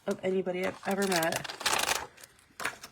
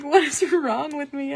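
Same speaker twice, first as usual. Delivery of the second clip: higher, louder, drawn-out self-questioning; mock distress; 6 semitones higher, 5 dB louder than usual. Woman retelling her sudden crying at work, mock distress. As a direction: wail it lightly in bafflement at yourself. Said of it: "I just started crying".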